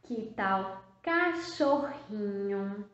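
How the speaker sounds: "cachorrinho" drawn out slowly; slow suggestion. Shown as a demonstration. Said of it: "como eu escreveria?".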